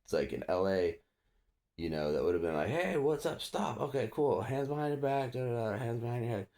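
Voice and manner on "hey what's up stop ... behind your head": lightly voiced cop, high pitch; imitated routine policing. LA cop giving routine orders, lightly imitated. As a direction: rattle off cop orders in a raised voice.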